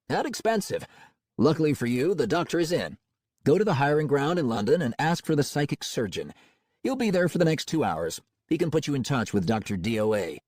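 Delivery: smooth cold-calling salesman; confident, warmly professional pitch. Acted.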